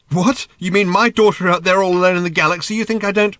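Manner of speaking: loud, high indignant retort. A narrator's reading. Arthur defending his worry for his daughter, indignant.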